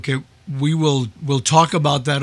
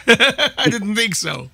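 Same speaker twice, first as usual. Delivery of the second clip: laughing; 7 semitones higher, slower than usual. Real, not acted. Laughing after missed spelling.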